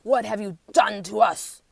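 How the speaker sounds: demand. A narrator's reading. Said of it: "demand the warthogs".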